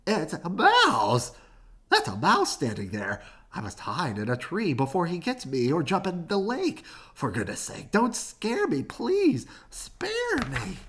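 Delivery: mildly panicky, rising to high pleading; panicked elephant. Acted. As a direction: beg not to be scared.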